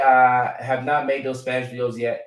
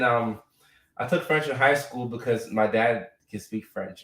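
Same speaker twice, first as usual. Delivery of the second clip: usual speaking voice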